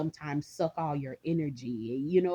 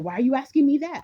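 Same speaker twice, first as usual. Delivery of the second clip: higher, louder, fast retold reply; startled; 8 semitones higher, 7 dB louder, faster than usual. Wife recalls answering her husband's call, startled and rushed. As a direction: blurt it out, caught off guard.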